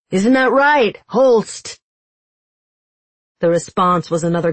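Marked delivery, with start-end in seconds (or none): 0.1-1.8 high, raised calling voice; loud, carrying. A narrator's reading. Kaz taunting the hidden guard on the roof, calling loudly. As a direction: call the question up to someone far above. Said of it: "his voice floating up to the roof".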